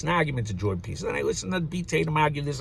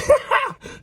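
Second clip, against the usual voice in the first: sudden loud high laugh; high burst of laughter; 7 dB louder than usual. Real, not acted. Laughing at his own joke, loud and high.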